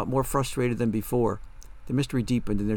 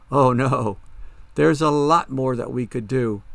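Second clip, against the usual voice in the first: loud, slow, emphatic threat; forceful, drawn-out menace; menacing threat; 2 semitones higher, 6 dB louder, slower than usual. Acted. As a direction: relish how much more harm is coming. Ghost threatens more harm.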